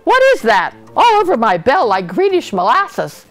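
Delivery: high, loud astonished questioning; startled dismay. A narrator's reading. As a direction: exclaim in shock at the sight. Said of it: "he gulped".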